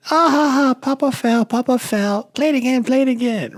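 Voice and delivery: put-on high, loud, giddy little girl laughing; excited granddaughter. Granddaughter begging to replay Papa's fall, high giddy child voice. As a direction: squeal it like a delighted small child. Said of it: "She will run in the room".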